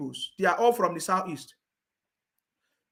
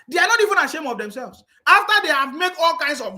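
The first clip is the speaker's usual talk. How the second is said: angry outburst; 6 semitones higher, 4 dB louder than usual. Real, not acted. Ranting at critics.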